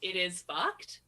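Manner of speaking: "It is fucked" with a questioning rise on "fucked"; no contraction, lift "fucked" like asking; question intonation. Retold or imitated. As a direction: imitate her cute questioning "It is fucked". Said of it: "she says it like a question".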